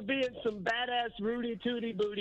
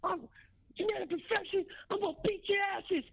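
wrestling promo; 12 semitones higher than usual. Retold or imitated. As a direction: ham up a pro wrestler interview. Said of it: "and he's like".

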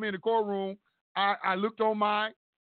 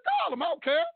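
defiant challenge; 8 semitones higher than usual. Retold or imitated. Defiant challenge, retold to host.

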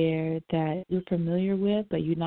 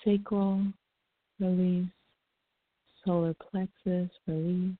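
calm them down breath by breath; soothing massage murmur. Acted. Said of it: "just a relaxing breathing".

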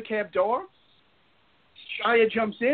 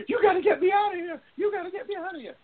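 high, whiny mock plea; desperate plea; 10 semitones higher than usual. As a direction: imitate someone frantically begging to escape. Retells LaBeouf desperately begging to leave.

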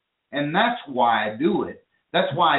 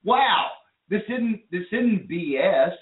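higher-pitched mock amazement at the videos; amazed viewer; 6 semitones higher than usual. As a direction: play an amazed viewer, voice lifted high. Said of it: "you go".

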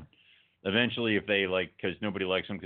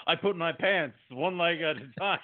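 a light, high-pitched impression of a film actor; Christopher Walken; 8 semitones higher than usual. Retelling Dad's plan to burst in, lightly imitating Walken. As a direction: quote it as a hint of a celebrity impression. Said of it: "I'll play Christopher Walken".

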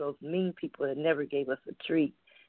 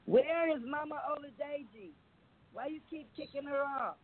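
mock plea; 9 semitones higher, 4 dB quieter than usual. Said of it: "a post up there that says".

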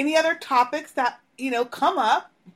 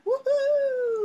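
cheering; 12 semitones higher than usual. Real, not acted. Cheering return for summer.

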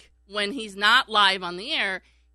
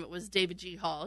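usual speaking voice

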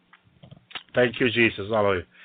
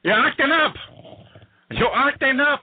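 higher, rising scold at the actors; God scolding; 7 semitones higher than usual. Acted. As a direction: call them out as a stern God, pitched up. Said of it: "God is saying".

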